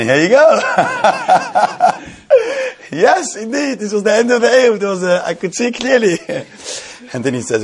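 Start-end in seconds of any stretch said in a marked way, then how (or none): 0.0-6.5 high, delighted, laughing through the words; real giggles bubbling up as you explain; laughing while telling. Real, not acted. Laughing while recounting the discovery.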